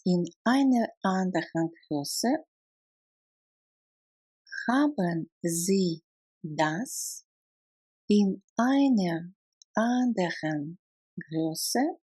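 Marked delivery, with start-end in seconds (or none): 4.7-12.0 word by word. Shown as a demonstration. Teacher breaks down a German question for learners, word by word. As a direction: break the question into small, careful pieces. Language drill, broken into words.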